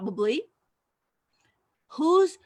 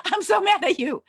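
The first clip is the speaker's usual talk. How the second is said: higher, louder mock yell at the machine; mock angry at computer; 9 semitones higher, 6 dB louder than usual. Woman scolding her computer in a hammed-up mad voice. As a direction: play-act fury at the computer. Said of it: "taking my computer and going".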